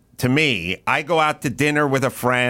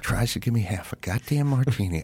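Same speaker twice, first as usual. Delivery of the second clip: muted, grumbled threat; retold seething; 3 semitones lower, 5 dB quieter than usual. Diner stewing before the waiter arrives, muted mock seething. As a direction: seethe to yourself, kept fairly quiet. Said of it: "I had a head of steam".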